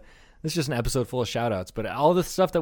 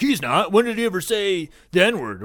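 higher-pitched imitation of defensive fans; lightly mocking objection; mock protest; 6 semitones higher, 4 dB louder than usual. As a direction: mimic an indignant defender, pitched up. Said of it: "will be like".

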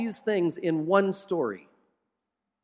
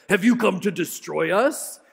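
demon voice; 3 dB louder than usual. Acted. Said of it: "this demonic guy interrupts".

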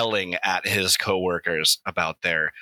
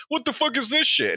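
annoyed devil. Acted.

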